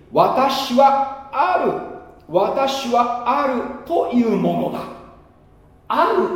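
high, slow, solemn divine pronouncement; God proclaiming. Acted. God naming himself to Moses, slow and high, solemn. Voicing God's self-naming.

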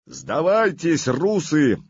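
slow, high, loud battle call; full-voice shout. Acted. Vladimir shouts to the enemy troops, full-voice shout. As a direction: bellow it to the whole field. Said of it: "крикнул он во всю мощь".

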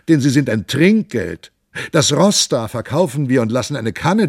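loud, wheedling poor devil pleading; hammed-up rogue fawning on soldiers; loud cringing trickster offering drinks; bawling beggar. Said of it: "Da plärrte Gymnastes".

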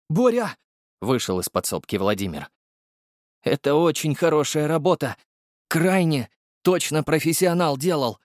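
high, excited craftsman voice; the potion maker admiring a potion, excited; eager alchemist. Acted. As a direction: marvel at the work, pitched high.

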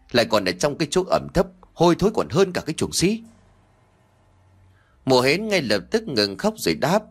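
ordinary delivery, nothing marked

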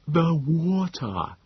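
high, very slow, labored creature voice; Nebogipfel. A narrator's reading. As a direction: drag out each syllable as if exhausted. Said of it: "His wheezing had grown so pronounced".

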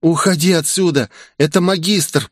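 usual speaking voice

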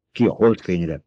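ordinary delivery

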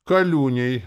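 loud, high, ready answer; willing, eager. A narrator's reading. Coachman tells passenger his name, eager. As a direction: give the name gladly. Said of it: "охотно сказал возчик".

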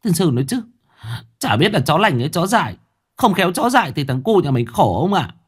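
wife fussing to her husband, half scolding; a mother fretting over her son; fretful wife complaining. Acted. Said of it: "vừa xót vừa phàn nàn với chồng".

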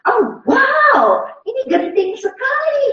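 high, loud cry of alarm; alarmed exclamation. Real, not acted. Speaker to parents, raising a high loud alarm.

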